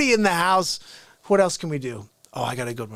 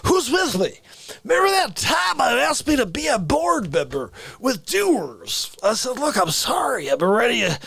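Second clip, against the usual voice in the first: put-on raised, sing-song old baseball announcer; Harry Carey; 3 semitones higher than usual. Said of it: "Harry Carey here".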